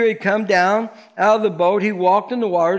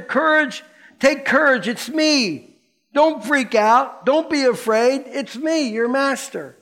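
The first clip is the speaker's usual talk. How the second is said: reassuring Jesus; 6 semitones higher than usual. A narrator's reading. Jesus calming frightened disciples.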